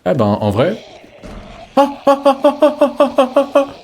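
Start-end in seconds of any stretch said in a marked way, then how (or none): 1.8-3.7 very high staccato "ah ah ah"; rapid falsetto chirps on an open A; evenly pulsed, squeaky A sounds; vowel outburst. Pretend.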